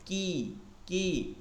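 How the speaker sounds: কি very slow and thin; thin kaf. Shown as a demonstration. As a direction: draw কি out, thin. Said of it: "কি, চিকন". (খুব ধীরে চিকন কি)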